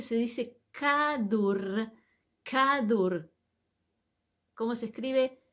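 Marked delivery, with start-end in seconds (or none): 0.0-3.3 kadur said very slowly, then repeated firmly; teacher dictates kadur to students, very slow; slow pronunciation demo. Shown as a demonstration. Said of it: "Cómo se dice".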